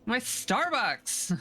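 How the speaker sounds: put-on slow, high valley girl whine; drawn-out valley girl wail. Joining the valley girl bit.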